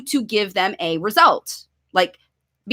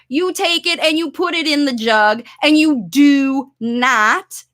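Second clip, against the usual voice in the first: ordinary talk, nothing marked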